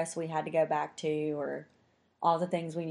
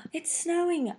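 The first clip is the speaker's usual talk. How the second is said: high, breathless wonder at the snow; amazed; 13 semitones higher than usual. Retold or imitated. The whole cafe room watching the snow, in high amazement. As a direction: say it like the snow is a miracle.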